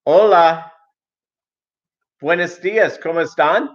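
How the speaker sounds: bright, high hola to the cashier; cheery Spanish greeting. Retold or imitated. Greeting the checkout guy in Spanish, high and bright. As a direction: greet him sweetly in Spanish.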